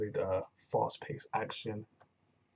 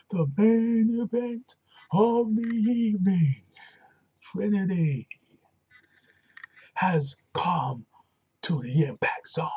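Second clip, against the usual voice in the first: much higher, much louder, stretched-out announcing; hyped announcer; 10 semitones higher, 10 dB louder, slower than usual. Real, not acted. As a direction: sell the main event like a ring announcer. Hyping the main event.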